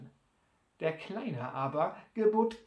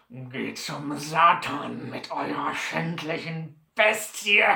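growled curse; 3 semitones lower, slower than usual. A narrator's reading. Sore Butler curses Sam and his mule, growling angrily. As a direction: growl it out, bursting on the last word. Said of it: "grollte der Gefragte".

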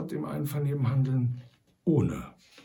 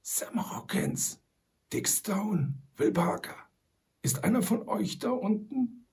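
a hushed call into the hole, higher; muffled call; 8 semitones higher than usual. A narrator's reading. Old Shatterhand calls into the hole to the captives, muffled. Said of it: "aber so gedämpft".

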